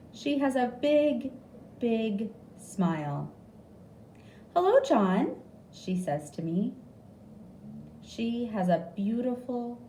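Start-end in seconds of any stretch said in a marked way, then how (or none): 4.5-5.4 high, bright hello; sing-song greeting to a patient; cheery nurse. A narrator's reading. Nurse greeting patient John, high and sing-song.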